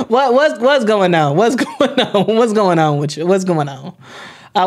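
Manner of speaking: normal talking voice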